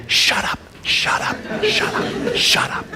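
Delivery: urgent whispered warning, repeated; inner voice whisper. Retold or imitated. Inner voice warning himself to stay quiet, whispered. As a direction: hiss it to yourself as a warning. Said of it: "little light ... in the back of my head saying".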